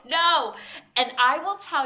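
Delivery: loud, high, emphatic yes and no; emphatic outburst. Real, not acted. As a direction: burst out with it. Passionate key lime pie rant.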